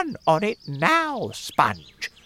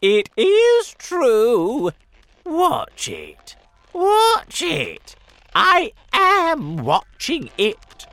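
insisting excitedly; 10 dB louder, slower than usual. Acted. Aunt insisting loudly.

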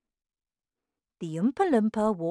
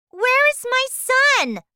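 high, loud, panicked wail; frantic mother's cry; 12 dB louder than usual. A narrator's reading. Mother demanding to know where her son is, crying out. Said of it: "cried Missus T V".

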